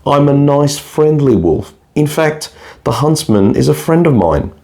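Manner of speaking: charming wolf. Acted. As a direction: sweet-talk her as the wolf.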